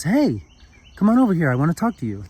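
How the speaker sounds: lively high-pitched call, full of friendliness; sparkling and loving. Acted. Small man calling a drunk over, sparkling and friendly. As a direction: call him over brightly, loud at first, then easing. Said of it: "sparkling and love and life in his eyes".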